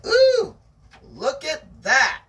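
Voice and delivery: high, loud delighted ooh; excited. Real, not acted. Reader announcing the final part to a friend, delighted. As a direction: gasp with delight at the new part. Reacting to reaching part three.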